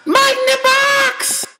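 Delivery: very high shouted mascot cry; cereal mascot. Acted. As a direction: shriek the slogan like a mascot. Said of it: "mascot shout".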